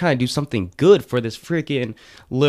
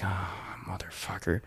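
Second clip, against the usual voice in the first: hushed, whispered curse; low, sinking mutter of "oh no"; muttered dismay; 4 semitones lower, 15 dB quieter than usual. Retold or imitated. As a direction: curse softly under your breath. Dread as cop approaches.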